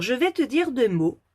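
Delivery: usual speaking voice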